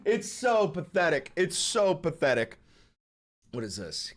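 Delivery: ordinary delivery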